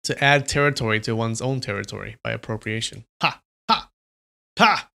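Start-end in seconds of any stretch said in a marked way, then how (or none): 3.2-4.9 loud triumphant ha ha ha; gloating staccato laugh; triumphant cackle. Real, not acted.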